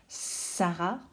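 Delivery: "Sarah" with a long hissed "Sss" at the start; "Sarah", first consonant drawn out; exaggerated first sound. Shown as a demonstration. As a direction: hold the opening S of "Sarah". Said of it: "Vous entendez le s".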